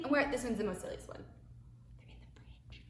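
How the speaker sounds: fast then hushed whisper; playful hushed aside. Real, not acted.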